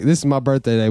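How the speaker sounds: ordinary talk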